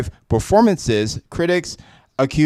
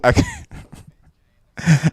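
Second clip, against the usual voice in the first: laughing; 4 semitones higher, 4 dB quieter than usual. Real, not acted. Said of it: "I couldn't read this with a straight face".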